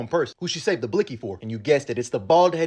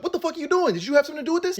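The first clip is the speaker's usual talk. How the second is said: much higher, shrill mock accusation; imitating Sophia's outrage; 11 semitones higher, faster than usual. Sophia accusing Oz during her arrest, high mock outrage. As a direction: throw it at Oz like a betrayed woman. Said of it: "she's like".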